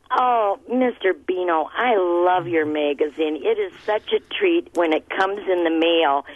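ordinary talk

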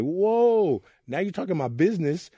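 sharp high startled whoa, then indignant; retold teenage shock, mock offended; shocked outburst. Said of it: "And I was like".

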